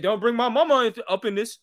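heated. Retold or imitated. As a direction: imitate a son touchy about his mama. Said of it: "literally turns red heated".

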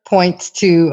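ordinary delivery

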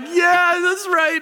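high, loud mock-emotional "yeah"; excited agreement. Coworker agrees about the episode's ending, excited. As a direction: agree in a hammed-up, overcome squeal.

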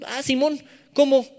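high, puzzled mock reply; child answering parent. Kid replying to parents' order, high mock confusion. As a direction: play a kid agreeing, then clueless. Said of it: "balconear a mis jefitos".

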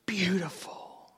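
slow, soft whispered love; Jesus murmuring tenderly. Acted. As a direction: whisper it over her weakness. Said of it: "he goes".

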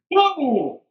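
high, drawn-out mock "whoa"; startled whoa. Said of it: "He went literally like that".

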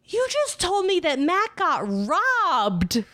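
high, slow, appalled retelling; horrified. Retold or imitated. Friend repeating the misheard bad news, horrified. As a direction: stretch it out in dismay.

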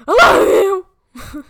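screamed, drawn-out mock-German declaration; horrifying German accent. Shown as a demonstration. Showing nice words sound horrifying, screamed German accent. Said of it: "nice things in a German accent, it's horrifying".

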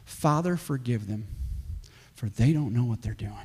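low, soft, pleading prayer; Jesus. Retold or imitated. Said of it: "John heard Jesus say".

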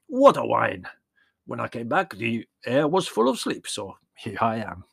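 high, loud, animated character voice; old shepherd, expansive. Acted. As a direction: tell it with relish and big swoops. Old shepherd tells of his day.

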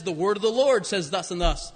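normal talking voice

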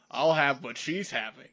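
lightly put-on British bloke, deadpan; hint of a fake British accent; bad British accent. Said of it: "a foreign soldier there".

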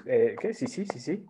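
usual speaking voice

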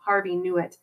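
ordinary delivery

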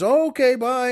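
high, loud sing-out farewell; breezy goodbye. Acted. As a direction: toss off a high-pitched bye before escaping. Husband slipping off after sly bargain.

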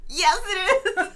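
high excited laughing cry. Real, not acted. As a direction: laugh it out with glee. Excited about the song.

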